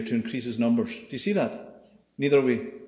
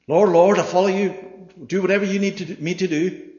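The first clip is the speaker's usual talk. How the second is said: mock eager pledge; 6 semitones higher, 6 dB louder than usual.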